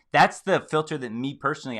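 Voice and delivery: ordinary talk, nothing marked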